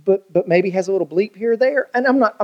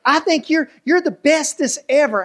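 cheery wife; 8 semitones higher than usual. Acted. Wife's cheery encouragement.

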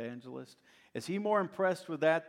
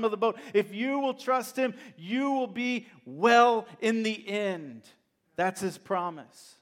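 rising, driving sermon delivery, louder and higher; preaching at full force; 6 semitones higher, 5 dB louder than usual. Acted. Preacher to the church reading Paul's hardships, full-force preaching. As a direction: build it like a fiery pulpit climax.

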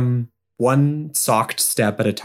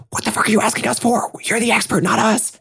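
much higher, fast indignant retort; snapping teen; 13 semitones higher, faster than usual. Acted. Graham snaps at Harriet, high and indignant. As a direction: snap it back high and quick. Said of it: "Graham looks back at Harriet and goes".

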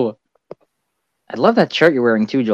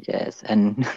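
usual speaking voice

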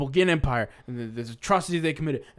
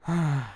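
long breathy sigh of resignation; dejected sigh. Acted. Window washer notices new spots, dejected sigh. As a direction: sigh sadly, as the let-down worker. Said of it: "he just sighs".

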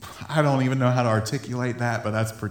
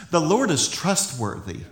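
usual speaking voice

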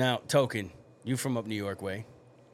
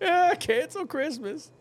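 mock high voice; slower than usual. Mocking the cancel Christmas joke.

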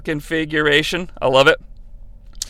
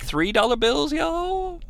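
much softer, higher quizzical aside; mock question; 8 semitones higher, 10 dB quieter than usual. Narrator echoing Graham, soft and high. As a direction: murmur it back, puzzled. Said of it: "And I'm like".

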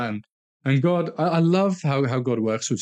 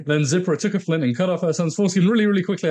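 reading quickly; 6 semitones higher, faster than usual. Shown as a demonstration. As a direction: rush through the verse, x1.4 fast. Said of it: "That's why I'm reading it really, really quickly".

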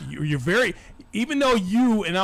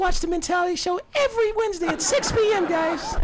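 very high, squeaky show plug; Chris impression; 11 semitones higher than usual. Host mocking his co-host plugging the show, high squeaky Chris voice. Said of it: "here's my Chris".

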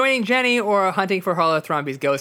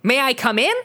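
put-on Chav accent; 6 semitones higher than usual. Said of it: "She has a Chav accent".

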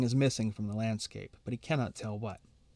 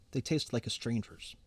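ordinary delivery, nothing marked

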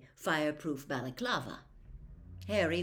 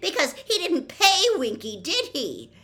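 much higher, louder indignant cry; voice rising shrilly; 13 semitones higher, 12 dB louder than usual. A narrator's reading. Hermione cutting Percy off, shrill and loud. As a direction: push the voice up in outrage. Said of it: "her voice rising shrilly".

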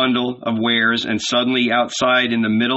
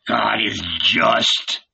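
sarcastic; slower than usual. A narrator's reading. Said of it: "throwing a sarcastic glance".

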